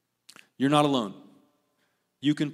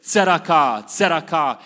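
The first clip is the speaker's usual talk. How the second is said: leading call-and-repeat; 7 dB louder than usual. Shown as a demonstration. Leads congregation in repeating a word.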